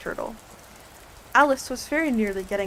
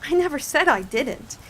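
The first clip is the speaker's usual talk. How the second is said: interrupting; 5 semitones higher, 5 dB louder than usual. A narrator's reading. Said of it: "interrupted Alice".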